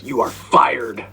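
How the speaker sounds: slow, high, loud mock firing; scream. Student firing the teacher in class, screaming. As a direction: draw it out in a loud yell. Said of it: "your student immediately screamed".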